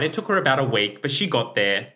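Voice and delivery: ordinary talk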